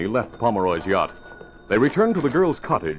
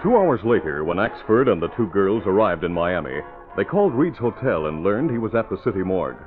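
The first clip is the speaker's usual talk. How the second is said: lower, steady story narration; radio drama narrator; 3 semitones lower than usual. Acted. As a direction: set the scene calmly.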